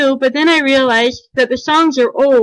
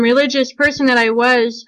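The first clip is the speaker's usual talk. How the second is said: ordinary talk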